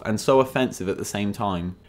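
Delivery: ordinary talk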